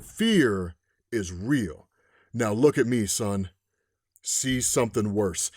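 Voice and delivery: low, slow ominous delivery; deep, drawn-out dark dialogue; menacing character voice. Retold or imitated. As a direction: intone it slowly and low, like a threat. Acting out menacing dialogue from story.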